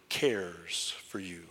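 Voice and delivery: slow whisper of "cares for you". Shown as a demonstration. Preacher whispering comfort to the congregation, slowly. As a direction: breathe "cares for you" as a secret. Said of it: "I'm gonna say it a couple times".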